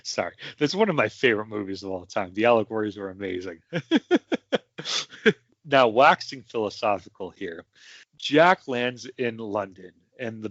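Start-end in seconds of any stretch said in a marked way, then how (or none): 3.6-5.3 a burst of high, giggly laughter. Real, not acted. Laughing at his own movie tangent, high giggle.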